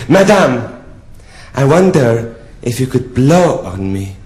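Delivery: slow French count, first word pitched high; French accent. Acted. French count asking the prostitute a favour, French accent. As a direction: request it in hammed-up French. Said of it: "he said to me in a French accent".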